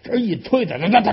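ordinary delivery, nothing marked

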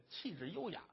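ordinary delivery

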